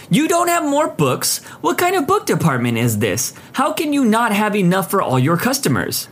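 high-pitched sarcastic disbelief; unimpressed, sarcastic. A narrator's reading. Customer to clerk about missing books, unimpressed and sarcastic. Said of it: "in an unimpressed, sarcastic voice".

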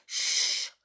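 sharp shushing hiss; a child silencing the others, hissed; hushing. Acted. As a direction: hush them now.

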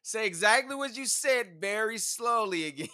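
a mock interrogator; loud mock demand. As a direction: boom it out like a playful cop. Jokingly demands a repeat.

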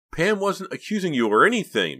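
high, loud defensive protest; crying out. A narrator's reading. Boy comes to Pam's defense, crying out. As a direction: jump in urgently to defend her. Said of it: "Mickey cried".

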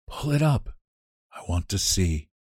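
soft, faint command; Johnsy quietly ordering Sue to raise the shade. Acted. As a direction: order it under her breath, weakly. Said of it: "she ordered in a whisper".

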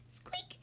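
very high, soft squeak; mouse squeak. Acted. Mouse squeaks at lion, tiny and high.

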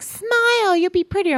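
high-pitched, sing-out imitation of a pushy stranger; mock cheery coaxing. Stranger telling a woman to smile, mockingly chirpy.

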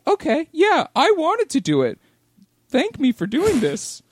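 high, hammed-up eager volunteer; mock eager volunteer. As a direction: squeak out a fake-willing yes.

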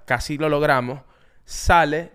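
ordinary talk

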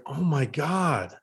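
slow, stunned imitation of a teammate; mock choked-up disbelief. Teammate absorbing the retirement news, mock choked-up disbelief. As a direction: draw it out like a friend close to tears. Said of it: "almost tearing up".

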